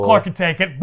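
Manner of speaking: ordinary talk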